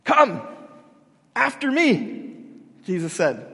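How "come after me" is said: loud, high, punched summons; emphatic command. Retold or imitated. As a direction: order them to come, drawing out the end. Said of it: "is more of a command".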